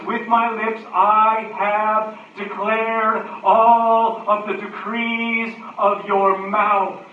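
high, slow declaimed scripture; loud, noisy verse. Acted. Preacher quotes the psalmist's declaration, loud and noisy. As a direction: proclaim it loudly, stretching the key words. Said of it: "notice how loud and noisy the verse is".

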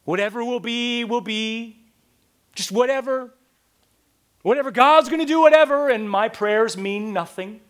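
scornful mock indifference; mocking shrug. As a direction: parody a lazy believer. Mocking passive faith.

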